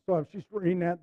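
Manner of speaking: ordinary talk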